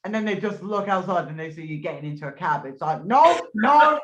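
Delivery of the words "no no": loud, high pretend cries of no; mock panicked refusal. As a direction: cry out as if fleeing, hammed up. Imagined escape by cab.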